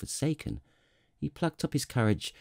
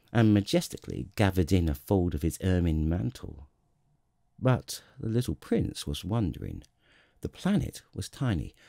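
usual speaking voice

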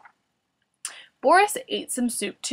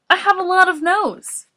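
high, louder character voice; silly patient's voice; 9 semitones higher, 6 dB louder than usual. A narrator's reading. Morris explaining the pile of tissues, silly high voice. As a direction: answer high-pitched in simple self-defence. Morris justifies himself to Boris.

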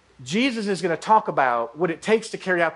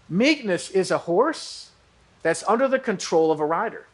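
ordinary talk

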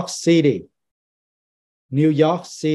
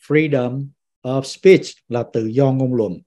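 ordinary delivery

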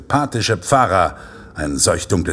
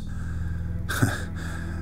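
soft villain chuckle; 10 dB quieter than usual. Acted. Killer laughs before his speech.

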